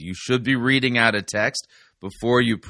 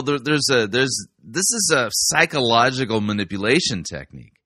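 ordinary delivery

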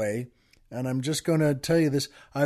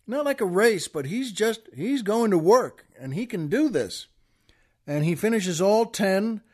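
ordinary talk, nothing marked

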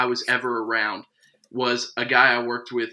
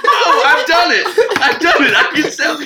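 loud, high, laughing triumph; retold triumph; 12 semitones higher, 6 dB louder than usual. Recalling fooling a Long Island mother, triumphant and laughing. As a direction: crow it through laughter, hammed up. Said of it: "And I was like".

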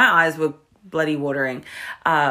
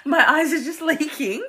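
high, giggling protest; laughing denial; 9 semitones higher than usual. Retold or imitated. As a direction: laugh through the excuse. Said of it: "I was like".

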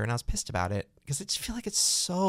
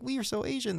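mocking poster voice; 11 semitones higher, 4 dB quieter than usual.

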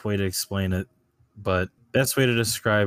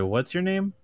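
ordinary talk, nothing marked

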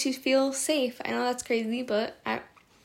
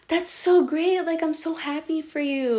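delighted cheer; 5 semitones higher than usual. Retold or imitated. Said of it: "my other self is like".

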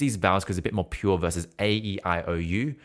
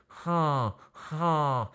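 nose blocked, muffled; muffled huh; 7 semitones higher than usual. Shown as a demonstration. Coach shows blocked-nose muffled sound. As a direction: pinch the nose and sing. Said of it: "sound quite muffled".

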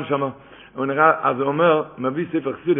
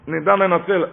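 ordinary delivery

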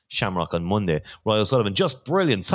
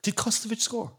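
much higher, startled question; surprised; 14 semitones higher, 4 dB quieter than usual. Real, not acted. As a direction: ask it in disbelief. Hearing of a surprise goal.

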